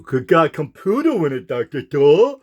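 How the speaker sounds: a lightly voiced dim fan gushing at the doctor; mock naive questioner. Mocking a gullible fan asking Doctor Thor, lightly voiced dim admirer.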